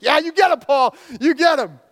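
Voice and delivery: loud, high cheering. Retold or imitated. Preacher cheering Paul on against the sorcerer, loud and high. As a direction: egg him on like a fan in the stands.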